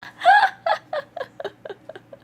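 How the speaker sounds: squealing high laugh; falling fit of giggles; high giggling burst. Real, not acted. Cracking up at the joke.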